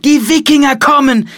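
high, loud warning call; shouted alarm. A narrator's reading. Boy calls to monks leaving church, shouted alarm. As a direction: cry out the warning to a crowd. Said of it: "rief Philipp ihnen zu".

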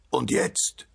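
choked, muffled question without full voice; stifled voice. A narrator's reading. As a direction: ask as if smothered under earth. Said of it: "mit erstickter Stimme". (mit erstickter Stimme)

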